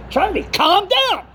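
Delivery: high-pitched retold plea to calm down; imitated pacifying caller. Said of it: "he called me from [name] to Charlie".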